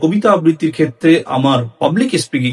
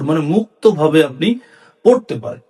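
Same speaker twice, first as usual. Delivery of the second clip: usual speaking voice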